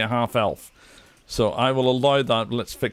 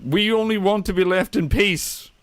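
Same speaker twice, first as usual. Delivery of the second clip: worried orc shaman; 5 semitones higher than usual. Acted. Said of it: "he looks around and goes".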